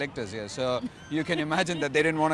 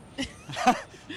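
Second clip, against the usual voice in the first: breathy laugh; 9 semitones higher than usual. Real, not acted. Laughs at rain question.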